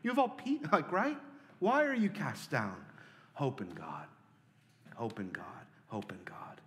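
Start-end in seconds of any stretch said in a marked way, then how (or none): 3.3-6.6 hushed reassurance. Acted. Repeated hushed self-exhortation.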